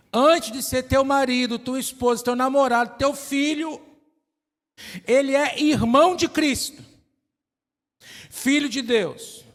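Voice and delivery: impassioned preacher; fervent preaching. Acted. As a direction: drive the point home to the couples. Preaching climax.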